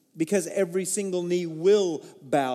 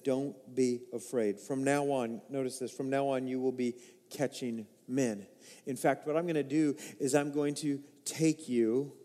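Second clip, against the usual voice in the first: tender promise; 5 semitones lower, 5 dB quieter than usual. Acted. Jesus's words quoted tenderly.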